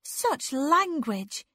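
scold, drawing the words out; prim, drawn-out disapproval; sharp scolding. A narrator's reading. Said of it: "Anne scolded sharply".

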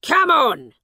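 high, loud wake-up shout; father shouting the boy awake. A narrator's reading. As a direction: yell him awake. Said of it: "he shouted".

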